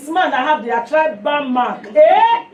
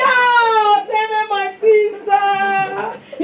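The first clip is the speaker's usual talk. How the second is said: higher, slow, crying out then singing it; joyful sing-song cry; 6 semitones higher, much slower than usual. Retold or imitated. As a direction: sing out the delight of a kindred soul. Stranger answers in shared language.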